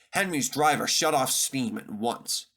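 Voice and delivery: usual speaking voice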